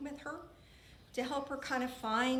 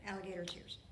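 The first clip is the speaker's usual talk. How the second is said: ordinary delivery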